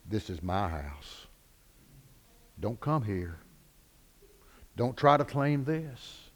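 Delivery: low, hushed, slow threat; quiet menace, spaced out; devil's quiet warning. Acted. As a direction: warn them off in a low, soft devil's voice. Devil warns people off his house.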